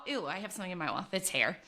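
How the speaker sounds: ordinary delivery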